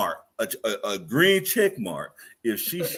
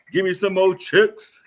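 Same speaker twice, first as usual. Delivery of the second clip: laughing through the demand; giggly, slow and high; 7 semitones higher, slower than usual. Real, not acted.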